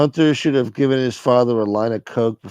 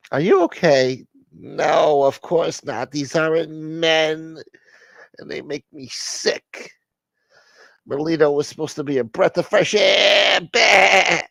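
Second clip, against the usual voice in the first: dramatic outraged woman; 8 semitones higher, slower than usual. Acted. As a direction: overplay the heartbreak and disgust. High voice ranting in skit.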